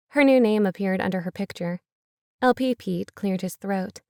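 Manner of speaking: ordinary delivery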